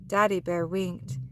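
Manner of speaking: usual speaking voice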